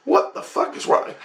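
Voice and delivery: high-pitched, outraged retort; incredulous outrage; livid. Retold or imitated. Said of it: "livid because I can't believe".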